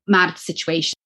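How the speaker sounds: ordinary talk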